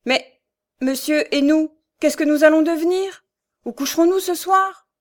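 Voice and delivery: high, loud pleading, rising to a cry; abrupt distressed plea. A narrator's reading. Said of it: "Thérèse intervint brusquement". (supplication affolée, haute et forte)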